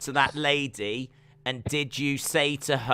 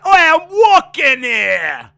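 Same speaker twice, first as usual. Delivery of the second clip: loud, high, drawn-out New York yell; brash New York accent shout; 9 semitones higher, 11 dB louder, slower than usual. Acted. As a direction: holler it like a hammed-up New Yorker. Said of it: "I'm from New York".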